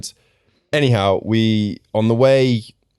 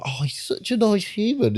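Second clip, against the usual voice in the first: high, soft gushing mock-surprise; surprised gushing; 12 semitones higher, 4 dB quieter than usual. Fan meeting a reality star, gushing high and soft. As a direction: gush like you can't believe he's nice. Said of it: "You're like".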